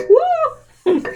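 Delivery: high-pitched little whoop; playful woo. Real, not acted. Seeing own face in the bowl, playful whoop.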